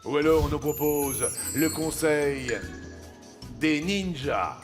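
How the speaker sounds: normal talking voice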